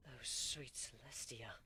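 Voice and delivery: plain reading voice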